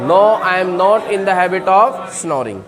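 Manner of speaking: slow, careful model answer. Shown as a demonstration. Teacher models the answer slowly for students. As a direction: give each word its own weight. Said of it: "तो answer क्या हो जाएगा?".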